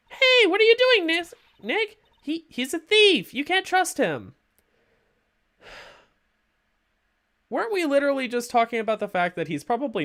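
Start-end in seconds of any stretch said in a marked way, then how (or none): 0.1-4.3 put-on much higher, louder protesting girl; spunky sidekick objecting, higher; alarmed girl. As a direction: cry out a warning in a high girl's voice. Voices alarmed teen girl character.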